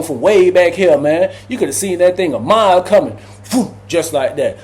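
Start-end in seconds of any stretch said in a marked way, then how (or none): none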